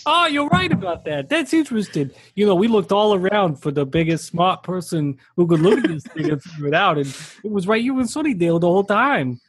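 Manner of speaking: mock Bronx, starting high then settling; comedy Bronx accent. Professor flattering the expert he called in, mock Bronx accent. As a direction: play a working-class Bronx character. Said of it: "Bronx accent or, like, some kind of working class accent".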